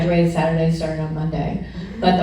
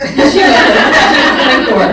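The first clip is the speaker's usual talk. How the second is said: laughing out loud, louder and higher; 7 semitones higher, 9 dB louder than usual. Real, not acted. Teasing a young classmate in the room, laughing loud. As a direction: crack up mid-sentence.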